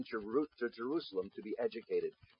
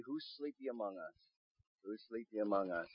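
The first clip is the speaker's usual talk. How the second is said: softly spoken, as if checking the room; hushed searching question; 3 semitones lower, 10 dB quieter than usual. Acted. Preacher looks around for sleepers, hushed voice. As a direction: ask it low-key and much softer.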